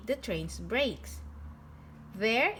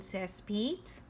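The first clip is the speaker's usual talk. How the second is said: plain reading voice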